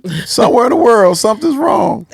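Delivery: high, fast whiny wail; mock whimper. Answering the scolder as a crier, high whiny wail.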